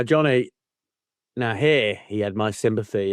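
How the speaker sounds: usual speaking voice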